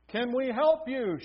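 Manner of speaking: calling out across the water; shouted. A narrator's reading. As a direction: call out to the other ship. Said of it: "shouted the Peruvians".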